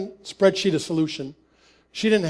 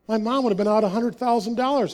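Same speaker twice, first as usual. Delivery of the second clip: ordinary delivery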